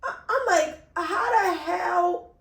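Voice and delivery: retold stunned outrage, drawn out; slow, incredulous reenacted outburst; exasperated disbelief. Woman retelling her shock at police losing her report, exasperated disbelief. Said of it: "like, that's how I felt".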